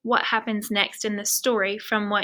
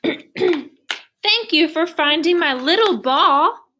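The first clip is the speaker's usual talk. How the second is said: slow, higher and louder giant whale; booming whale voice; 6 semitones higher, 5 dB louder, much slower than usual. Acted. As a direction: loom over the little ones. Whale thanks them.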